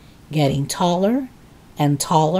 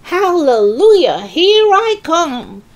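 high, loud hallelujah cry, last words drawn out; exultant shout; 10 semitones higher, 4 dB louder than usual. A narrator's reading. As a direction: crow it triumphantly, stretching the ending. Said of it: "finding her voice at last".

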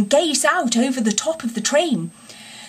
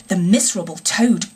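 usual speaking voice

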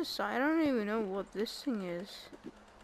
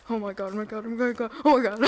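rising panic, getting much louder; panicked; 10 dB louder, much faster than usual. Real, not acted. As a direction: panic as the mob closes in. Attacked by mob, panicking.

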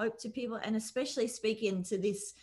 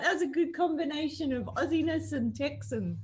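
retelling the reaction through a laugh, pitched up; laughing quote; 7 semitones higher, 3 dB louder than usual. Retold or imitated. Wife recalling the daughter's doormat gift, laughing as she quotes. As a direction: giggle your way through the line. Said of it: "We were like".

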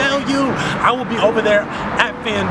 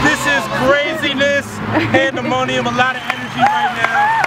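excited shouting; 6 semitones higher than usual. Real, not acted. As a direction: yell it with wild hype. Hyping the finish.

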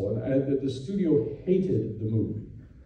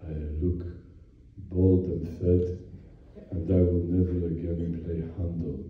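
much lower, softer mock German actor grumble; grumbling old actor; 10 semitones lower, 5 dB quieter than usual. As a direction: grumble it low under your breath. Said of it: "And he starts going like this".

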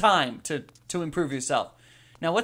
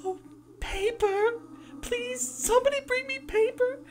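put-on high wailing ghost girl.

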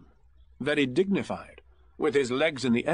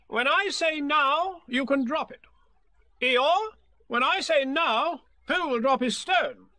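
much higher, bossy organizing voice; commanding Rabbit; 12 semitones higher than usual. Acted. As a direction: Rabbit taking charge of everyone.

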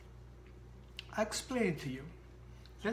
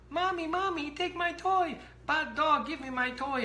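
put-on much higher child voice calling for mommy; whiny child; 10 semitones higher, 4 dB louder than usual. Child calling mommy to take the dog's toy, high child voice. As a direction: play a small kid tattling to mommy.

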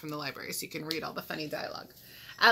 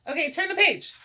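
high, loud call to move on; raised, eager call; bright cue; 10 semitones higher, 6 dB louder than usual. Acted. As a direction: announce it excitedly after the count. Cue after counting in.

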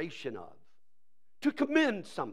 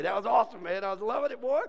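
usual speaking voice

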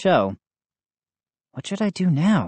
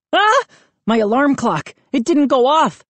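high, loud jolt of panic; startled panic; 11 semitones higher, 5 dB louder than usual. A narrator's reading. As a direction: yelp awake, already late. Wakes up late, panics.

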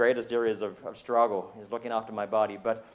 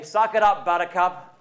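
loud, higher tough-love jab; mock scolding; 6 semitones higher, 7 dB louder than usual. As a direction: bark it like a drill sergeant. Mock scold God doesn't give.

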